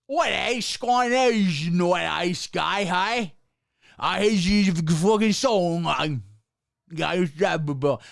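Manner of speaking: a lightly mocking Australian accent, slow and loud. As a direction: garble it like a bad Australian accent copy. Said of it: "It's like an English accent".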